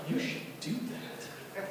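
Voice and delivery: hushed, whispered suggestion; quiet conspiratorial whisper; whispered urging. Retold or imitated. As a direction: whisper it like a dare. Friends egging him on.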